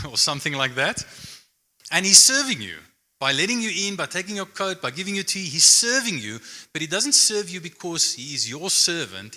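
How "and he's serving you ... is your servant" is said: raised pitch and louder, driving the point home; climbing emphatic sermon voice; emphatic preaching. Real, not acted. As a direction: preach it with rising fervor.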